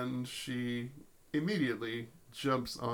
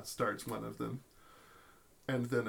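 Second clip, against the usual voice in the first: ordinary delivery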